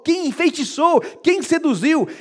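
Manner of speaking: bem mais alto e agudo; indignação gritada. Acted. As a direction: cobra indignado a resposta. Paulo repreende com indignação. (Paulo indignado gritando as perguntas)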